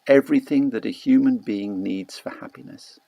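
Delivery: usual speaking voice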